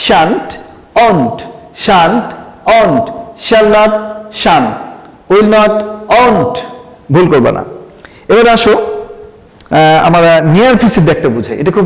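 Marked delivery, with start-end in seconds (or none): 0.1-6.6 shan't and won't said clearly, short vowel; pronunciation drill. Shown as a demonstration. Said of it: "উচ্চারণটা ঠিক করে নাও". (shan't, won't স্পষ্ট উচ্চারণে বলা)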